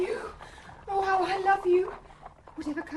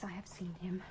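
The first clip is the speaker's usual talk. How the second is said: lower, hushed whisper; 9 semitones lower, 15 dB quieter, faster than usual. Acted. Celia admits to Jonas she has seen Richard, whispering. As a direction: breathe the answer so no one hears.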